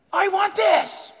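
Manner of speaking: put-on high, slow, drawn-out childish demand; hammed-up greedy toddler; whiny demanding child. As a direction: whine it like a spoiled kid. Mocking our demands like the kid.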